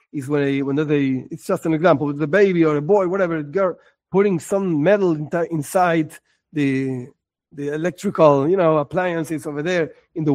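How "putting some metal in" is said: usual speaking voice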